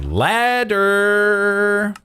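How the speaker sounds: "ladder" held very high; silly high, long "ladder"; high drawn-out word. Pretend. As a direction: stretch "ladder" out on a high, sung-like note. Silly repeated word while crafting.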